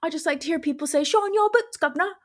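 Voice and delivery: high, hammed-up British accent; bad British accent. Comedian retelling her club joke in a bad British accent. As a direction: put on a cartoonish British accent. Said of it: "as bad as my British accent".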